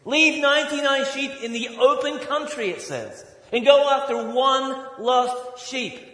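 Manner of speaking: high, loud, scoffing preacher; loud mock outrage. Pastor mocking the crowd's logic, loud and high. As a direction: bellow it like a scandalized businessman.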